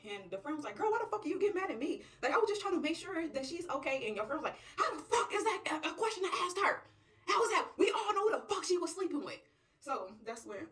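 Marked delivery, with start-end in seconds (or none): 0.8-4.1 high, indignant protest; defensive. Acted. Friend told to shut up, protesting indignantly. 4.8-9.4 high, loud, shouted outrage; outraged. Acted. Real friend scolding the nosy friend, outraged.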